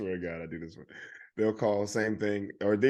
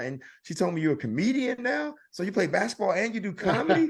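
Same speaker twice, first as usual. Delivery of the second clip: friendly salesman; 9 semitones higher, 3 dB louder than usual. Retold or imitated. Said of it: "starts talking about".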